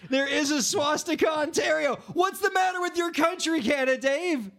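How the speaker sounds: horrified at the town's name; aghast. Real, not acted.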